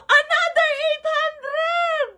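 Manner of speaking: high, drawn-out exclamation of disbelief; amazed. Real, not acted. Streamer announcing another big gift, amazed. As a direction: stretch the amazement out on a high pitch.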